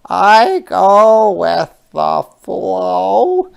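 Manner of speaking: high, loud, very slow otter; a playful otter sliding. Acted. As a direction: otter diving down. Otter speaks in story.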